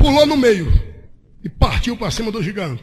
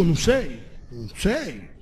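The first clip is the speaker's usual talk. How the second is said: ordinary delivery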